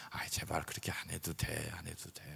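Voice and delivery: pleading whisper. Retold or imitated. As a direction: hush them, begging. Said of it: "unvoiced whisper". (간절하게 속삭이기)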